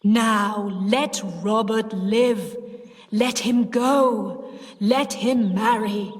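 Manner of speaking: slow, ghostly command; deep voice. A narrator's reading. Sis as Henry commanding her aunt, deep voice. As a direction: draw out each order like a spirit. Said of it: "Sis said in the deep voice".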